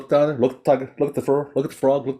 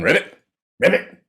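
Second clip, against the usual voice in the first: short, quick frog croaks; little storybook frog call; frog ribbit; 6 semitones higher than usual. A narrator's reading. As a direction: imitate a frog going ribbit. Storyteller imitates the frog's croak.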